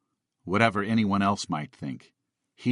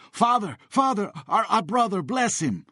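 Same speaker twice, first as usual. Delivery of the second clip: put-on men begging the priest to bless the boy, screaming; screaming plea; 11 semitones higher than usual. A narrator's reading. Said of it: "the men screamed to Sudatz".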